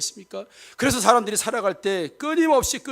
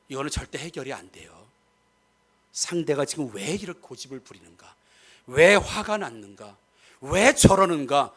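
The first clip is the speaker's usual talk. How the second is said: ordinary talk, nothing marked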